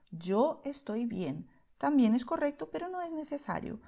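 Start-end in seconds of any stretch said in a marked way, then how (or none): 0.1-1.5 slow clear model. Shown as a demonstration. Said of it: "añadir el sujeto y el verbo".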